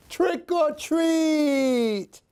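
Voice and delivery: high, slow, sing-song kitty voice; cute slow trick-or-treat call; kitten trick or treat. Acted. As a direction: say it like a little cat at the door. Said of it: "said Lily".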